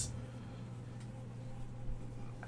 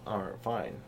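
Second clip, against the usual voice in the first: low, soft giving-in; soft, deflated concession; resigned acceptance; 5 semitones lower, 9 dB quieter than usual. Retold or imitated. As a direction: let it drop, quietly resigned. Acts out own resigned reaction.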